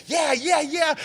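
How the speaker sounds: very high, loud startled yeahs; shouted yeahs. Retold or imitated. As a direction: Damon Wayans yelling while being lifted. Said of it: "he's, like, going like".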